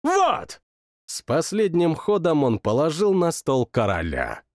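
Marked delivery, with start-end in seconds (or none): 0.0-0.6 very high triumphant cry. Acted. King plays the last card, high triumphant cry. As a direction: slam the winning card.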